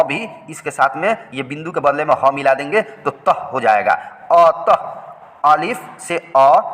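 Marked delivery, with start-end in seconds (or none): 4.3-5.5 अतः stretched very slowly, ending in a breathy h; slow spelled-out अतः. Shown as a demonstration. Teacher sounds out अतः slowly for pupils. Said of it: "ते और हे से तह".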